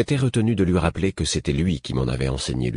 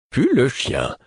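higher-pitched astonished echo; startled repeat; 8 semitones higher, slower than usual. A narrator's reading. Prince repeating the message he received, startled. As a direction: echo it in surprise.

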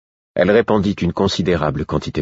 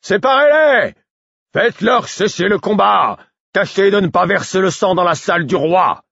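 high, loud orders over the tumult; commanding shout; 13 semitones higher, 3 dB louder than usual. A narrator's reading. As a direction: order the guards to stop the fight. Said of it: "la voix de Kerf s'éleva".

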